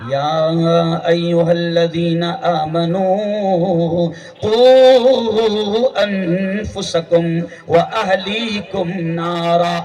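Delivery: slow, drawn-out Arabic recitation; قرآنی آیت کی دھیمی تلاوت; Quran recitation. Acted. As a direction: intone the verse with reverence.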